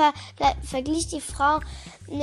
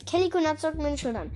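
ordinary delivery, nothing marked

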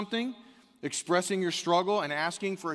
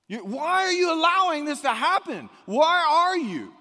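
anguished plea; 12 semitones higher, 7 dB louder than usual. Acted. Preacher voicing the psalmist asking God why, loud plea. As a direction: plead with God, stretching the why. Psalmist's cry voiced again.

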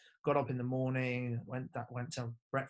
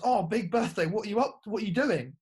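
bright, higher-pitched chummy coach voice; cheery coach; 8 semitones higher, 7 dB louder than usual. Retold or imitated. Coach quizzing the player at breakfast, higher and chirpy. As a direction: play the oblivious coach asking keenly. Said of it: "He goes".

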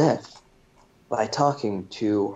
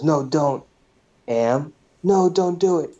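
rising higher, hurried no don't; urgent plea; 5 semitones higher than usual. Retold or imitated. Parrish frantically stopping Joe, urgent plea. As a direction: cut him off before he says it.

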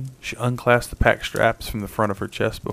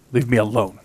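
snapped; 7 dB louder than usual. A narrator's reading. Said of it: "Kat snapped".

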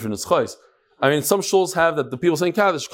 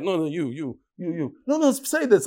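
mock politeness. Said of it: "they're all polite".

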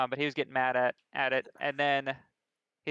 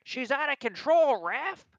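high, slow whiny protest to the ref; high drawn-out complaint; mock yelling complaint; 11 semitones higher, 4 dB louder, slower than usual. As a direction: mimic a grown man whining at the ref. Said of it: "he starts yelling".

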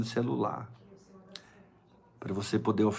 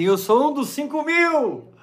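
excited boast; 6 dB louder than usual. Pretend. Imagined believer boasting.

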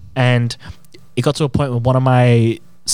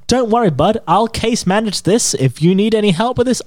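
very high, sweet customer-service voice; sugary helpful agent; 10 semitones higher than usual. Retold or imitated. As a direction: chirp it like an overly cheerful agent. Promising customer help, retold.

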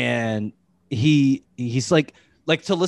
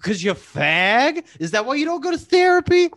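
put-on high, ranting Boston accent; Bill Burr impression; 11 semitones higher than usual. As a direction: needle him like a Boston comic. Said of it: "Bill Burr's not too hard".

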